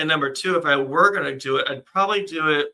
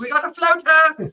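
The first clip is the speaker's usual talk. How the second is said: high, loud British cry of alarm; hammed-up British accent, very high; mock British accent; 13 semitones higher, 7 dB louder than usual. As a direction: call it out in a silly British voice. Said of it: "saying it with a British accent".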